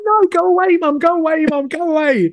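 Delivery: put-on very high, loud childish whine; whiny kid voice. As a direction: shoo her out like a bratty kid. Kids shooing mum out.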